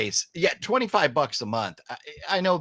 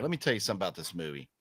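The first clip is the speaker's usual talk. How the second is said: ordinary talk, nothing marked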